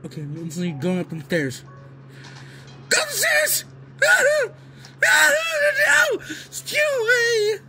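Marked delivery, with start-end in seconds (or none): 2.9-7.6 high yelping shouts while fleeing; frantic cries. Real, not acted. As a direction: squeal as you run.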